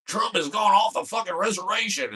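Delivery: Alex Jones impression. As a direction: yell it like an unhinged talk-radio host. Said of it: "And he's, he's".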